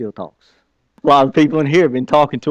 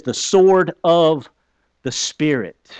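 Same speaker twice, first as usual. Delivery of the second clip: drawn out, each word weighted; slow scripture quote; 2 semitones higher, much slower than usual. Shown as a demonstration. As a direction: let the verse land slowly. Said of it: "slow quoted scripture".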